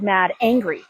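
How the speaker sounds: normal talking voice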